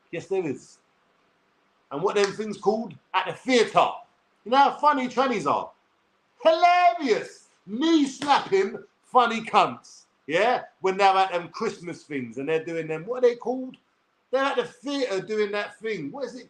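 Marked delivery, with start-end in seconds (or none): 6.4-10.0 drawn-out praise. Real, not acted. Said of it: "exaggerated praise".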